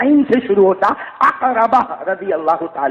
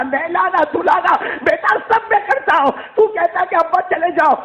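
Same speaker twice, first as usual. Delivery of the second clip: much higher, voice breaking into tears; father crying over who will care for his son; weeping father; 9 semitones higher than usual. Acted. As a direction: plead through sobs. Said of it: "ابا نے روتے روتے کہا".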